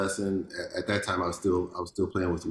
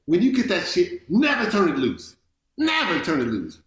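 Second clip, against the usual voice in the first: loud, high yell of a fierce mentor; imitated yelling; 10 semitones higher, 5 dB louder than usual. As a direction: retell it as an insistent yell, punching each never. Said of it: "yelling, you know, like, yelling at me".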